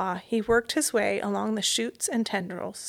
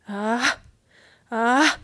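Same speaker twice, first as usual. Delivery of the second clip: rising ah before a sneeze; sneeze build-up; 2 semitones higher, 4 dB louder than usual. Acted. Mouse fighting a sneeze under the clock, building. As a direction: hold back a building sneeze. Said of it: "struggled to control a sneeze".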